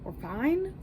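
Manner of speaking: rising, mock-cheery reassurance; sing-song we're fine. Real, not acted. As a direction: brightly insist all is fine. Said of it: "I've only had one coffee".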